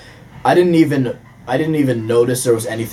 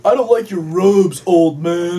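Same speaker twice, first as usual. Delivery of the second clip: petulant whine; 5 semitones higher, slower than usual. Pretend. Mocking Kylo's insult.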